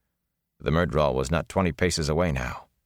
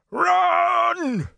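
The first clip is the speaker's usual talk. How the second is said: much higher, louder scream; screamed word; 4 dB louder than usual. A narrator's reading. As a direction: scream it mid-fight. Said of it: "in the middle of the scream".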